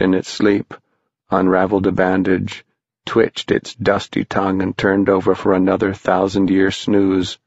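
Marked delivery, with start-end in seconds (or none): none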